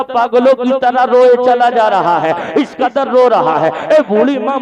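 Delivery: usual speaking voice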